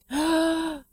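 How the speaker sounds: sharp gasp of wonder; awed gasp. Retold or imitated. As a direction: gasp as if seeing your newborn for the first time. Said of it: "and I was like".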